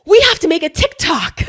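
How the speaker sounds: loud, high-pitched excited exclamation; excited announcement. Retold or imitated. Wife springs a TikTok on husband, excited. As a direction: burst out with the urgent plan.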